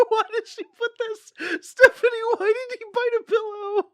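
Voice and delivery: loud, very high laughing wail; squeaking in disbelief, nearly crying with laughter; real hysterical laughter. Real, not acted. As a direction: wail the question through helpless laughter. Losing it laughing at the author.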